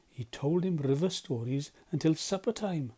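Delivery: ordinary delivery, nothing marked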